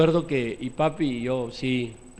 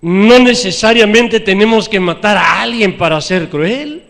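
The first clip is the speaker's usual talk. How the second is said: loud, higher sermon cry; preaching at full force; 5 semitones higher, 11 dB louder than usual. Acted. Preacher confessing his cruelty to the church, loud. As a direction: proclaim it to the church.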